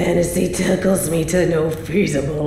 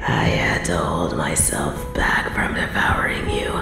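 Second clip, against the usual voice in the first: low, breathy, savouring murmur; hungry near-whisper; 9 semitones lower, 5 dB quieter than usual. Acted. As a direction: sink into a whisper as if inhaling the scent. Captor savours captive's scent.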